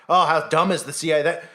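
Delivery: normal talking voice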